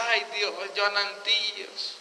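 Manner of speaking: soft, slow plaintive aside; mock lament. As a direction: sigh it out like a resigned onlooker. Acts out onlooker lamenting to God. (queja resignada, suave y lenta)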